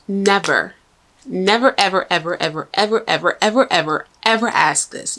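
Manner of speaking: "never" et "ever" répétés, chaque mot appuyé; emphatic never ever. Acted. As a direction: hammer every ever, building. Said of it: "Ladies and gentlemen".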